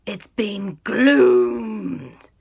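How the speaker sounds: loud, very drawn-out exclamation; dramatic alarm. Acted. Friends discovering the haunted cat, dramatic alarm. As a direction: stretch the last word in mock horror.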